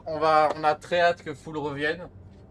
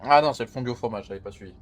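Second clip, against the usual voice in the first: chewing while talking; 7 semitones lower than usual. Real, not acted. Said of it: "on m'entend manger".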